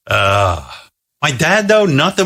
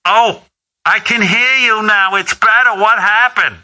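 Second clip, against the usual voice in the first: mom voice; 11 semitones higher, slower than usual. Retold or imitated. Mother voiced in phone story.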